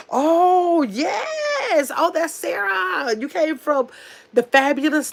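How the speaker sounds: high squealing laugh of delight; excited squeal. Real, not acted. Host squeals at a returning viewer, high and delighted. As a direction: squeal with joy at seeing her name.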